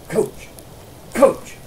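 Smitty waking the coach with an urgent call. Retold or imitated. Smitty calls out in the night.

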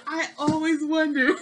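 real laughter cracking the words; laughing. Real, not acted. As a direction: get the words out while still laughing.

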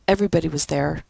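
ordinary delivery

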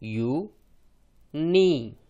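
यू, pause, then नी with long ee; sounding out. Shown as a demonstration. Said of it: "और आई का उच्चारण ई".

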